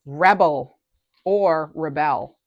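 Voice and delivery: "Rebel" first stressed on the first syllable, then on the second; two readings of REBEL. Shown as a demonstration. Tarot reader offering both readings of the card, REBEL stressed two ways. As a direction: say "Rebel" as noun, then "rebel" as verb, slowly. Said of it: "however you want to read the word".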